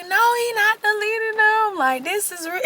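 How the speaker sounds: squeaky high naive insistence; high-pitched mock protest. Defending him to her friends, a squeaky high protest. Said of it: "I was like".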